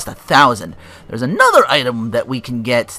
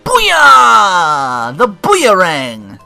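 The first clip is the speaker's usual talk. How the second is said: loud, high, drawn-out whoop; triumphant shout; 7 semitones higher, 11 dB louder, much slower than usual. Pretend. Announcing the new boomerang to viewers, triumphant shout. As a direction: belt it out like a hammed-up item fanfare.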